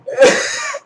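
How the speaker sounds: shrill burst of laughter; high squeaky laugh. Real, not acted. Laugh after scare.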